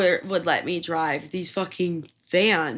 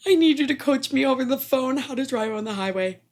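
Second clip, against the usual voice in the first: frantic plea; 8 semitones higher, 4 dB louder than usual. Retold or imitated. Begging mom on the phone.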